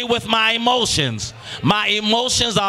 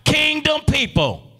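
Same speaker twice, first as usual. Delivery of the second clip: usual speaking voice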